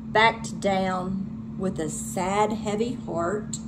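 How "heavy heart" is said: ordinary delivery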